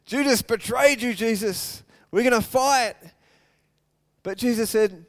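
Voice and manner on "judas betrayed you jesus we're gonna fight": loud, high eager cry; itching for a fight; eager disciples. Retold or imitated. Disciple calling to Jesus, loud and eager. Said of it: "He's like".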